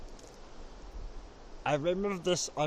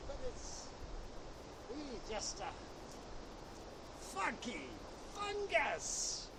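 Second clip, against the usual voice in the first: soft, higher mock wildlife narrator voice; hushed nature documentary; 9 semitones higher, 22 dB quieter than usual. Vlogger parodies a wildlife film narrator, hushed and soft. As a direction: murmur it like a nature film host creeping close.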